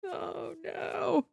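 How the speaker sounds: ordinary delivery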